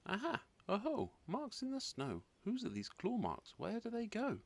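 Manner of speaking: put-on high, eager child calling out; excited discovery. Gruffalo's child spotting claw marks, high excited voice. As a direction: squeal it out at the find.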